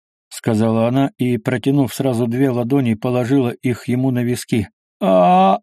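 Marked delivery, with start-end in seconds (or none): 5.0-5.6 short loud, high yell; cut-short "aah" yelled loudly. A narrator's reading. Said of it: "заорать медведь". (громкий высокий вопль)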